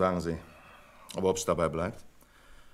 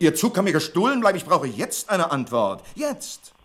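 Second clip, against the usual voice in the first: angry outburst; 7 semitones higher, 6 dB louder than usual. Acted. Manager loses patience on phone.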